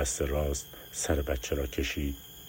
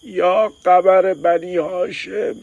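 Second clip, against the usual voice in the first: high loud slow wail; shouted plea; 14 dB louder, much slower than usual. Acted. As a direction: call out the saint's name in agony. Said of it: "داد زد". (فریاد بلند و کشیده)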